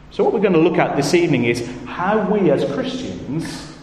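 normal talking voice

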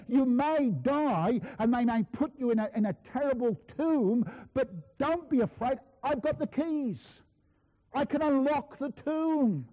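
high, slow, ringing pulpit proclamation; impassioned preaching. Acted. Christ reassuring Christians at Smyrna, impassioned triumphant preaching. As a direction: build to a triumphant declaration from the pulpit.

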